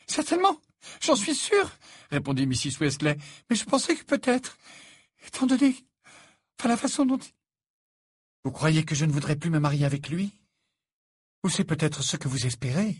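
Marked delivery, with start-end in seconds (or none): 0.1-1.7 very high, loud placating voice; flustered mother. A narrator's reading. Mother placating the indignant fiancée, flustered. 3.5-7.3 very high, hesitant explanation; faltering mother. A narrator's reading. Mother trying to explain her doubts, flustered and faltering. 8.4-13.0 higher, mildly affronted questioning; indignant challenge. A narrator's reading. Fiancée accusing the mother of hoping so, indignant. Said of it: "lança Fleur".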